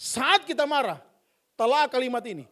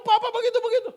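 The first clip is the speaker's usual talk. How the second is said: put-on whiny child; 10 semitones higher, faster than usual. Child whining at papa.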